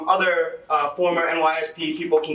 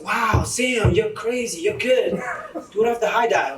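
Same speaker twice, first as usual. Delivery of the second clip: crowd praise; 5 semitones higher than usual. Retold or imitated. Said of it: "everybody's like".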